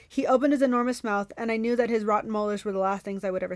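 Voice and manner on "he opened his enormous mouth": ordinary delivery, nothing marked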